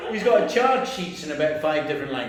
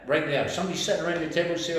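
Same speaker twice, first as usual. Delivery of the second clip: ordinary talk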